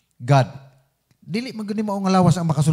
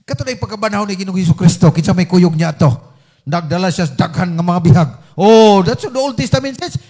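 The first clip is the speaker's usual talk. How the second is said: louder, driving sermon delivery; fervent preaching; 2 semitones higher, 6 dB louder than usual. Acted. Preacher drives the point home to the congregation, louder. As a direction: preach it out to the hall.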